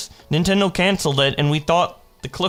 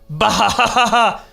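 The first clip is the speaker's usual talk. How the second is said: loud high burst of laughter; laughing bah; 6 semitones higher, 4 dB louder than usual. Real, not acted. Laughing at own joke.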